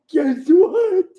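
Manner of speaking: high, loud mock shout; excited bragging shout. Wrestler mocks Bret's bragging with a high mock shout. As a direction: bellow it like a boastful show-off.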